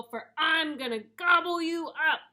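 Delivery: high, loud troll threat; storybook troll. A narrator's reading. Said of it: "said the troll".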